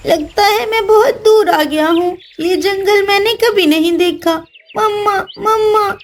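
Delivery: high, calling for mama; a lost chick close to tears. Acted. Lost chick in forest crying.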